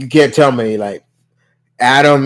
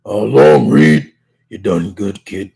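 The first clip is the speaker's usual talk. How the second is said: as if gravel in the mouth; Michael Chiklis; 4 semitones lower than usual. Acted. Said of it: "he deepens his voice".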